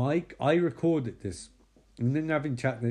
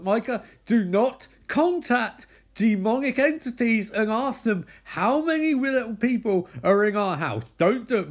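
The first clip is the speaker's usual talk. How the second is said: higher, louder frantic warning; Katie losing it; 9 semitones higher, 4 dB louder than usual. Retold or imitated. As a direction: forbid him, voice climbing and pushed. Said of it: "Kate is losing her fucking shit".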